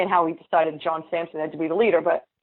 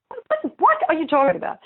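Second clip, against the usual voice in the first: high-pitched disbelief, stammering; what-what-what, much higher in mock shock; incredulous; 11 semitones higher than usual. Senator retells denying the accusation, incredulous and high.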